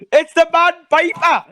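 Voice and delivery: high, loud alarmed character cry; hammed-up cry in a put-on voice; Jamie impression.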